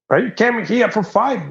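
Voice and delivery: high imitated trucker's demand; challenging trucker. Trucker confronting the gig-seeker, high-pitched challenge.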